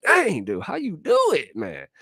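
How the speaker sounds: high squeaky admiration; mock awe; amazed falsetto. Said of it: "So I'm like".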